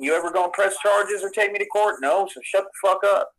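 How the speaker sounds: normal talking voice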